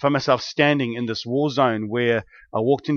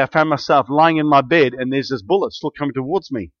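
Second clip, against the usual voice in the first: usual speaking voice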